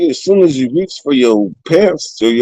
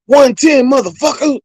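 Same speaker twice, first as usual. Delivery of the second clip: high defiant snap of the cell number; defiant shout; 12 semitones higher than usual. Retold or imitated. Cellmate defying the officer, high and defiant. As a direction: toss the number back in his face.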